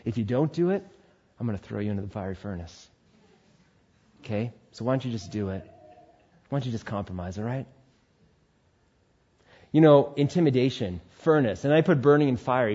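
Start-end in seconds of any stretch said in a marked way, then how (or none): 0.0-7.7 soft, low, menacingly calm king; calm soothing threat. Acted. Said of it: "said in this calm, soothing voice".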